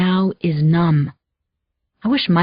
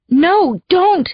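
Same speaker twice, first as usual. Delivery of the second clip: high, sharp cry, much higher pitch; panicked outburst; 10 semitones higher, 3 dB louder than usual. Acted. Begging Maria not to mention the call, panicked. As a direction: blurt it out in a panic. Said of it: "I catch myself and settle down".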